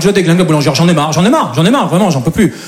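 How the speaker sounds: ordinary talk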